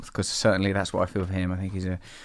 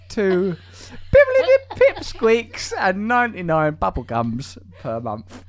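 higher, slower joking voice; silly mock price; 8 semitones higher, 3 dB louder, slower than usual. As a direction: puff up the nonsense currency.

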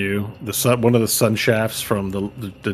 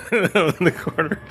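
laughing; 9 semitones higher than usual. Real, not acted.

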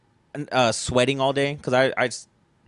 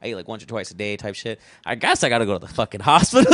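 ordinary talk

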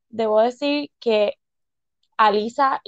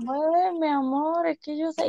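slow, soft, higher-pitched husband imitation; imitated husband; 4 semitones higher, 5 dB quieter than usual. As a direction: mimic a husband gently hedging. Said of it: "Y me dijo".

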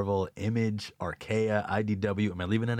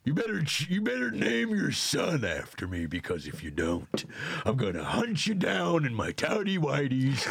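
Jack Nicholson; 4 semitones higher than usual. Acted. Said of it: "test my Nicholson on you".

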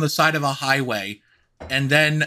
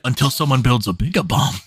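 low, mock-grave movie line; mock Robin Williams; 6 semitones lower than usual. Dream Robin Williams quotes Oppenheimer, low mock-grave voice. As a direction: deliver it like an ominous trailer line. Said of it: "Robin Williams walked up to me and said".

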